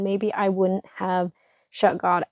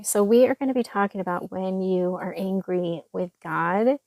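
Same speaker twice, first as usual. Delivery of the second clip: ordinary delivery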